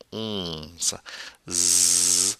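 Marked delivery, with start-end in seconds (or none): 0.1-1.0 "Onze" with a drawn-out nasal vowel; slow, nasal model of "Onze"; through the nose. Shown as a demonstration. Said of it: "Get it coming through the nose".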